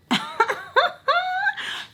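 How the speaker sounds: very high-pitched burst of laughter. Real, not acted. Laughing at her own charger deal, high and squeaky.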